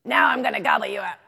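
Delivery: loud, quick troll shout; menacing troll. A narrator's reading. Troll threatening the biggest goat, shouting fast. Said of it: "said the troll".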